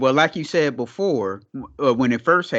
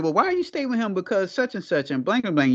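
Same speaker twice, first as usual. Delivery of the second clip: high incredulous quote; 7 semitones higher than usual. Retold or imitated. People questioning her choice.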